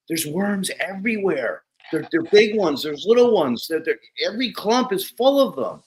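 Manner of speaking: high-pitched imitation of a worried farmer; hammed-up dismay, high voice; mock alarm. Farmer retold reporting worms in alarm.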